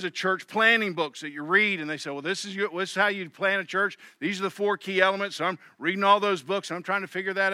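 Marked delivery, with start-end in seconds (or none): none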